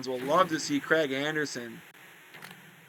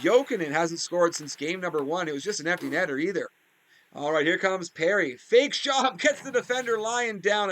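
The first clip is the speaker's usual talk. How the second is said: lively hockey commentator calling the action; play-by-play announcer; 4 semitones higher than usual. Acted. Gamer commentating his hockey game to viewers in announcer voice.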